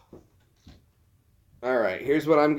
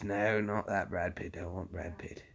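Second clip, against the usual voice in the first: whispering; 5 semitones lower, 12 dB quieter than usual. Real, not acted. Muttering to himself.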